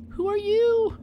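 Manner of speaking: very high, scared little monster voice; high-pitched timid creature, frightened; trembling fright. A narrator's reading. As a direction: squeak it out in storybook terror. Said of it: "Scaredy Boo trembled".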